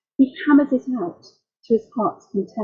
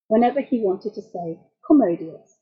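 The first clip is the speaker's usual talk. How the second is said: ordinary talk, nothing marked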